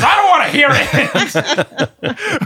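high, loud, drawn-out refusal; hammed-up squeal through laughter; mock exasperated cutoff. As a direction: cut him off, cracking up. Usher cutting off the kid, acted.

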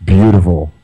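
beautiful set apart and leaned on, pitch dropping; pause, then land hard on beautiful; beautiful given its own weighty beat; stress on beautiful. Real, not acted. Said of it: "with an emphasis on the word".

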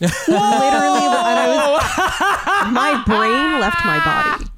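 loud, high and slow disbelief; shocked outburst. Real, not acted. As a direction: shout it like your mind just blanked. Recalls shock, shouting loudly.

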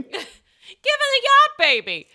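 high, loud, drawn-out comic squeal; mock distress. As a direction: wail it slowly in pretend panic. Acted panic over crying yacht babies.

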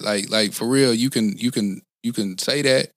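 usual speaking voice

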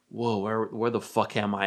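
ordinary delivery

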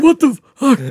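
very high, loud, startled outburst; hammed-up high-pitched alarm; mock panicked yell. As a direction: imitate a friend yelling in disbelief. Friend panics at pistol.

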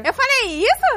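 high, startled disbelief; asking in shocked disbelief. Real, not acted. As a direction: was that really me. Disbelief at own past words.

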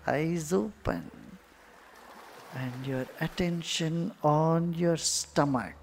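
unhurried cues with long silences between; guided meditation. Acted. Guru guiding meditators' attention to the belly, guided meditation. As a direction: guide their attention inward, letting words stretch out.

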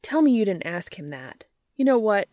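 loud exasperated outburst; can't believe she asked that. Acted. Exasperated at friend's question.

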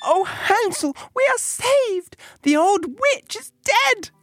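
put-on very high, jubilant little girl; elated high child voice; girl crying out joyfully. A narrator's reading. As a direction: exclaim the good news in a tiny high voice. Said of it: "unlocked his stall, and cried".

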